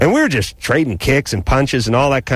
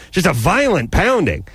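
ordinary talk, nothing marked

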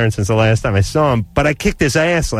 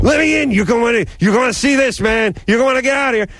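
much higher, pushy hollering; urgent drunk yell; 10 semitones higher than usual. Retold or imitated. Drunk friend yelling through door.

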